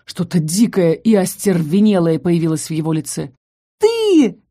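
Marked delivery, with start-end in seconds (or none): 3.8-4.4 very high, piercing squeal; shrieking ghost. A narrator's reading. Chained ghost at the girl, high shriek. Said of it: "визжал призрак".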